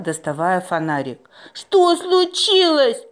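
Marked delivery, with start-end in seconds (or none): 1.5-3.0 high, slow worried voice; high anxious question. A narrator's reading. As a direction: ask it high and scared. Frightened question in dark cave.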